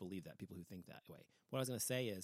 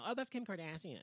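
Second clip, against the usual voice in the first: higher, bright-eyed mock recognition; mock eager recognition; 7 semitones higher than usual. Mocking students who know Kim Kardashian, eager higher voice. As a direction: play the student who instantly knows the celebrity.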